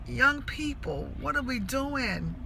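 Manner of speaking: soft, disappointed lament; hushed, heartbroken plea. Retold or imitated. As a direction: say it to yourself, with long pauses. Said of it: "it just broke my heart".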